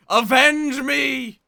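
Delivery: slow, drawn-out dramatic shout; overacted death scene. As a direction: bellow it like a dying ghost. Murdered victim cries for vengeance.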